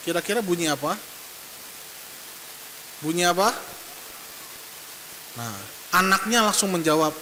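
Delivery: normal talking voice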